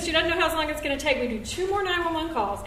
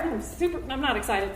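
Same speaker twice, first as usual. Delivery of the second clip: ordinary talk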